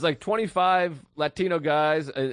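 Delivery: ordinary talk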